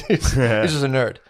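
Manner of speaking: said through a chuckle, slowly; laughing. Real, not acted. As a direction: laugh while teasing him. Teasing laugh about the nerd.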